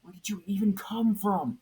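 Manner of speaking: high, loud startled scream; startled hero screams at the ghost. A narrator's reading. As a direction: scream in fright. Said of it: "Superman screamed".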